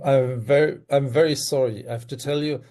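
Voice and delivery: usual speaking voice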